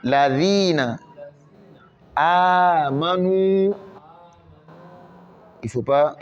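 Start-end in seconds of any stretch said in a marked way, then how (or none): 2.1-3.7 Amanu very slow, A stretched; long A. Shown as a demonstration. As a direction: drag out the first A of Amanu. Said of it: "négliger le A qu'on doit tirer".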